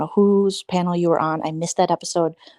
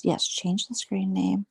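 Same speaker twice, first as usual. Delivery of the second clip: normal talking voice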